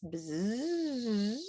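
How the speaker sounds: sustained buzz swelling up and back down; one smooth unbroken bzzz; long bee buzz. Shown as a demonstration. Teacher asking students about articulation, smooth legato buzz. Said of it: "did we just do with our buzzes".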